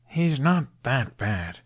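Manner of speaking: lightly voiced boy, slow and defensive; a hint of young Hiccup making excuses for his father; Hiccup to his dragon. Hiccup defends his dad to Toothless, slow and gentle.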